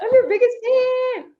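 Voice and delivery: very high, drawn-out fan holler; mock loud cheering. Sidelined player showing how she'll embarrass her friend, mock fan cheering. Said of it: "I'm gonna cheer so loud for her".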